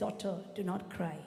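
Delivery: low, very soft reassurance; tender, hushed comfort; gentle consoling. Acted. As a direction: comfort a grieving daughter quietly. Jesus consoles a weeping widow.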